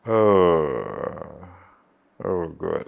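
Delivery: choked-up oh God; overcome with emotion. Real, not acted. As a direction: caught by a painful memory, overwhelmed. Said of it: "I'm sorry, I'm being emotional right now".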